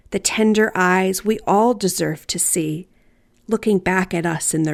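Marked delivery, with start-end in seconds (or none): none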